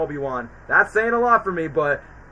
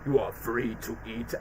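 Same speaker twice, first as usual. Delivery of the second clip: quiet master's voice; 6 semitones lower, 8 dB quieter than usual. Retold or imitated. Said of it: "the chief was like".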